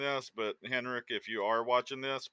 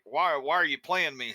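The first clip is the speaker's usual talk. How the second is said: exasperated mock complaint, a bit louder; mock protest; 4 semitones higher, 6 dB louder than usual. Player voicing a daily gripe at the game, mock protest. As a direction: protest it with rising and falling pitch.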